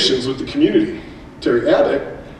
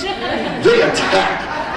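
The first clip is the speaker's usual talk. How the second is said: laughing; much slower than usual. Real, not acted. Joking about Apache helicopter parents.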